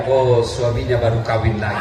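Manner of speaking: deep, put-on angel voice mocking the wife. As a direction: drop low and play the angel teasing.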